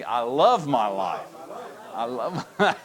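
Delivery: preacher leading the congregation in repetition; enthusiastic. Shown as a demonstration. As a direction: proclaim it gladly. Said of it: "Repeat after me".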